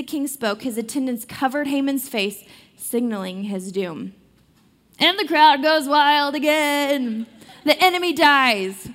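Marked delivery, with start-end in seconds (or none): 5.0-8.9 louder, slowed cheering announcement; mock cheering. Storyteller cheering the villain's defeat, playfully loud. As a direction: hype it like a crowd roaring.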